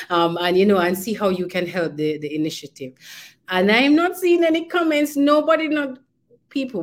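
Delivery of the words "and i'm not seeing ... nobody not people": high, loud mock dismay at the empty chat; pitched-up pretend grievance; mock complaint. Real, not acted. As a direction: playfully scold the silent audience. Host complains no comments.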